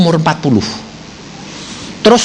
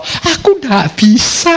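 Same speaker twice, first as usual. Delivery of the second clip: slow, drawn-out mock whine; whining excuse; 4 semitones higher, slower than usual. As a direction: mimic a lazy excuse. Congregation's excuse imitated. (keluhan pura-pura yang lambat)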